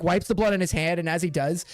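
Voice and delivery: normal talking voice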